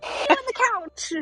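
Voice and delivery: high shrill disgusted squeal. Retold or imitated. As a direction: squeal it like you're grossed out. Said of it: "And I'm like".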